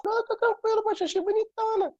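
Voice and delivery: squeaky high helium voice; tiny voice from a balloon. Retold or imitated. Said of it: "a gente chegava com a voz assim".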